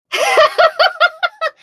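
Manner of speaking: high loud burst of laughter. Real, not acted. Laughing at her boomerang team.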